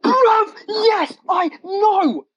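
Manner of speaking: high, stretched-out exclamation after a gasp; excited agreement. Real, not acted. Agreeing with co-host's vaccine suspicion, real excited outburst. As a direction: cry it out high, dragging each word.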